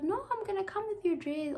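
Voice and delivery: high-pitched reassuring quote; friend's reply. Retold or imitated. Friend promising to bring the dress, in a high voice. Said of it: "she's like".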